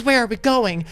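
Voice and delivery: lightly voiced victim. Kidnapped dad asking his captor, mildly bewildered. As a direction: pitch it up as a puzzled kidnapped dad. Acting the kidnapped dad's question.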